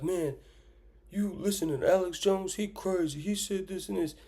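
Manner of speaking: high mock voice of a dismissive skeptic. Mimicking people who scoff at Alex Jones. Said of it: "Everyone be like".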